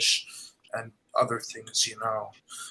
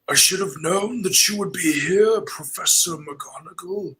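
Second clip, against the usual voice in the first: put-on higher, louder old English wizard voice; Dumbledore impression; 6 semitones higher, 4 dB louder than usual. Shown as a demonstration. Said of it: "Professor Dumbledore from the Harry Potter series".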